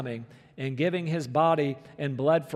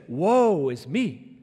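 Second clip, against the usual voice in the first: lament; much slower than usual. Retold or imitated. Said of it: "he said".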